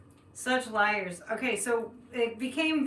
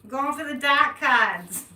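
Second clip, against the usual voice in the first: slow, louder Boston accent, "cards" said "cahds"; 4 semitones higher, 8 dB louder, slower than usual. Shown as a demonstration. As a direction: lean into Boston, dropping the r in "cards". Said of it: "as we say in Boston".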